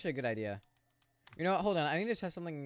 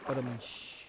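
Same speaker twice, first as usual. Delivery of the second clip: mouthed ba-dum-tsh drum sting; rimshot imitation. Streamer does a rimshot after a weak joke. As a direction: make the drum sting sound.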